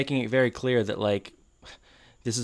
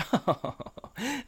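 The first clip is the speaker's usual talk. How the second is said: high fake chuckle; high mock laugh; 13 semitones higher, 6 dB quieter than usual. They laugh it off, retold.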